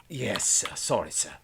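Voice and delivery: meek put-on apology; lightly voiced cowed underling; chastened crewman. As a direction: back down before the officer. Crewman apologises to his officer.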